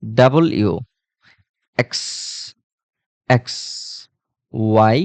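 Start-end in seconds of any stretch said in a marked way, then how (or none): 0.0-0.7 "ডাবল ইউ" as double plus you; corrected letter W. Shown as a demonstration. Teacher repeating for students, "ডাবল ইউ" as two separate words. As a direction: keep "ডাবল" and "ইউ" apart. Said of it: "কি বলতে হবে?".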